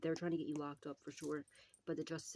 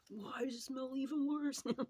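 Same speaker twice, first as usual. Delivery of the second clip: disgusted demon; 9 semitones higher than usual. Retold or imitated. Trapped demon complaining about smell.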